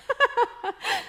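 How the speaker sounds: a high, light giggle; preacher giggling at her own habit of joking. Real, not acted. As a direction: laugh at yourself for a moment. Laughs at her own joke.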